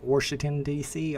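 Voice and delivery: "Washington" said as "Warshington", with an R added; mom's Washington. Retold or imitated. Imitating mom on a quiz, "Warshington" with the R. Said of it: "she'll be like".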